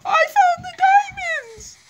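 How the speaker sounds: high, slow screaming cry of joy; excited scream. Real, not acted. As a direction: scream out the big find. Finding diamonds in Minecraft.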